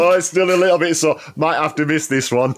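mock sore player. Jokingly mimics a sore player.

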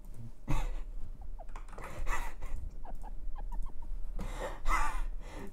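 very high breathy villain cackle; high evil giggle. Acted. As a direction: cackle wickedly.

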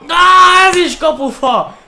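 high, loud outcry at the post; excited hungarian sports commentary. Real, not acted. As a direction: yell out in shock. Shot hits the post.

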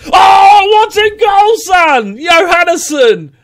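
rising to an ecstatic shouted goal call; goal celebration. Real, not acted. Gamer roaring at his player's stunning goal. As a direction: roar the goal like a commentator.

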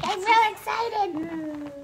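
very high, stretched-out pet voice; mock-excited, high and drawn out; excited dog's voice. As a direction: voice the dog in a slow high squeal. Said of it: "Yo, she's like".

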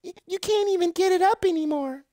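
high, put-on woman's voice; mock girlfriend. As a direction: play the let-down girlfriend, high and breathy-free. Acted woman in bedroom skit.

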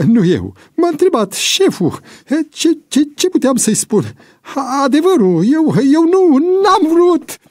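very high, loud stammered excuses; panicked pleading. A narrator's reading. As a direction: plead desperately, voice climbing. Cornered informer stammers excuses. (scuze disperate, bâlbâite)

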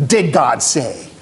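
low, drawn-out insinuation; slow, sly questioning of God's word; doubting question. Acted. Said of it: "our flesh who buys into".

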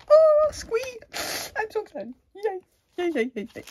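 very high, loud squeal of glee; mock squeal. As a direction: squeal like a thrilled kid. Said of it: "is like".

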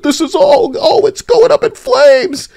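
shrill mock panic at the gaming news; mock alarm. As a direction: ham up a panicked doomsayer. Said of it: "people who like to pretend".

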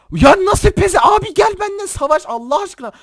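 very high, loud strained protest; outraged yelling. Real, not acted. Gamer begging opponent to come fight, outraged screaming. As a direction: shriek your exasperation at the opponent.

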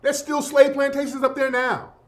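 ordinary delivery

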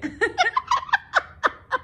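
very high cackling bird laugh; a shrill parrot cackle. Real, not acted. Owner shows viewers the parrot laughing. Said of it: "Miren cómo se ríe".

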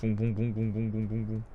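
low buzzing vroom; engine noise. Pretend. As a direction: hum like a little engine. Imitating flying vehicle.

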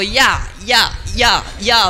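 rhythmic mock exertion grunts; yoga grunts. A man doing morning yoga, mock straining grunts. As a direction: huff it out like straining yoga breaths.

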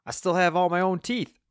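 high, loud character voice; hard-of-hearing loudness. A narrator's reading. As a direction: announce it loudly, oblivious to the question. Deaf Wayne answers off the point.

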